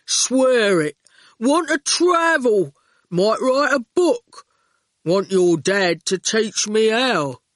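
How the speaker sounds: slow, earnest, heavy; slow goon voice. A narrator's reading. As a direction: plead simply and slowly.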